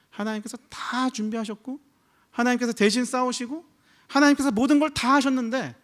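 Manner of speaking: preacher stacking what God did, building up; rising preaching. Acted. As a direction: build to a fervent peak. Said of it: "building preaching list".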